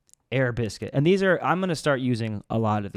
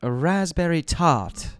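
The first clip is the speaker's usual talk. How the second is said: put-on posh English accent; 5 semitones higher, slower than usual. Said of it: "English ones that are, like, very fancy".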